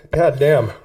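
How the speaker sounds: ordinary talk, nothing marked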